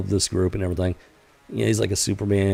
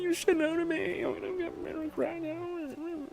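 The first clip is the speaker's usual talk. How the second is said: mock tantrum; 5 dB louder, slower than usual. Hero leader mocked as a five-year-old after being turned down, high whiny tantrum voice. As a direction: pitch it way up like a kid throwing a fit, loud at first then trailing off slow. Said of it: "He literally throws a tantrum".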